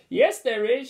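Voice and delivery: high called-out heckle; cheeky shout. A narrator's reading. Joker heckles from the back, cheeky shout. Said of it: "shouted the Joker from the back".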